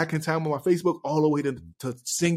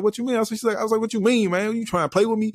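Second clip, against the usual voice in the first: higher, offended pushback at the doubter; retelling how he bristled at the girl doubting his song, raised and offended; indignant retort; 7 semitones higher than usual. Retold or imitated. Acting out his offended reply to her, pitched higher.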